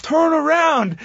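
high-pitched mock yell at a referee; imitated yelling. Bruce and Owen yelling at the ref, high mock yell. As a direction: yell it high, mimicking frantic ringside shouting. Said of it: "just yelling at the ref".

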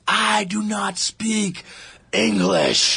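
slow, drawn-out stage announcement, starting high and dropping; Japanese hardcore singer. Retold or imitated. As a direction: imitate a band frontman labouring through English. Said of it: "the only thing he could say in English".

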